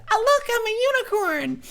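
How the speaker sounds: very high-pitched mock unicorn voice; mocking cutesy voice. Mocking what a unicorn onesie announces, high cutesy voice. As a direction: squeak it out in mockery.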